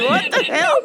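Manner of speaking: high, incredulous, cracking up; laughing disbelief. Retold or imitated. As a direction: gasp it out mid-laugh. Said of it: "my coworker looked at me like".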